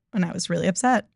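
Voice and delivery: normal talking voice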